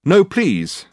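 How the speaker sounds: high, loud interjection; urgent protest. Acted. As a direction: cut in to stop someone. Dirk stops her opening a letter.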